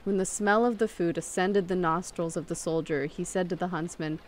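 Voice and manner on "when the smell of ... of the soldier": plain reading voice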